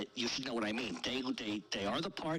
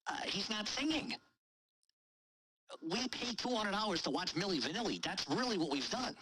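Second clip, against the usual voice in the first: excited retort; 6 semitones higher than usual. Retold or imitated. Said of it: "And I said".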